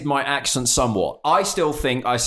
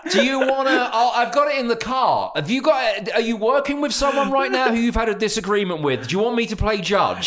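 mock eager offer; 8 semitones higher than usual. Offering strangers a judge's wig with mock eagerness. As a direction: sell the wig gag with fake enthusiasm. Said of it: "My new thing's gonna be".

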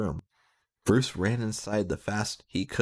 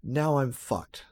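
thought; 7 semitones higher, slower than usual. Acted. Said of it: "thought Bruce".

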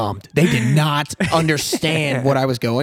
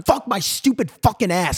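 retold yell; 7 semitones higher than usual. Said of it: "I'm saying the yellingness in the lounge".

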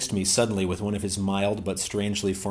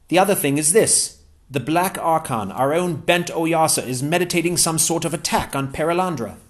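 higher-pitched, eager English gentleman; Ransom's voice; 7 semitones higher, 5 dB louder than usual. Acted. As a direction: pitch Ransom up, glad and keen.

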